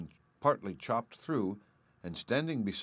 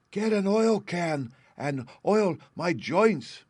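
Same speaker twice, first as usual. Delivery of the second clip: high, slow, louder plea for oil; pleading Tin Man; 9 semitones higher, 7 dB louder, slower than usual. A narrator's reading. Stuck Tin Man begging Dorothy for oil, high and slow. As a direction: draw it out like stiff tin joints begging.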